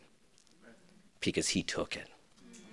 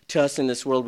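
ordinary talk

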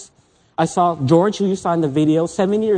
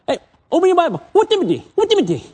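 put-on high, eager Singlish-accented friend; imitating Singlish friend; 9 semitones higher, faster than usual. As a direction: mimic a Malaysian friend in Singlish. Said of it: "they got an accent".

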